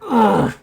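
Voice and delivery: short high involuntary groan; little escaped groan. Acted. Gagged priestess being pressed down, a reluctant groan. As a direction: let a small, high groan slip out. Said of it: "a little groan that escapes her".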